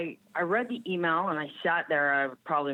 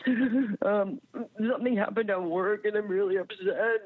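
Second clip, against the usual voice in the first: higher, tearful retelling of calling dad; upset on the phone; 7 semitones higher than usual. Retold or imitated. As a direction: pitch it up like a shaken young coach calling home.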